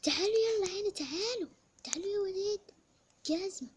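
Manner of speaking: put-on high, loud call to the kids; mother calling the kids to come. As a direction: call the children over.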